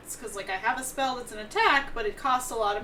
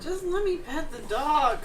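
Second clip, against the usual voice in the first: pleading; 6 semitones higher, 5 dB louder, slower than usual. Real, not acted. Pleading to pet dog.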